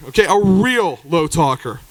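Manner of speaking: normal talking voice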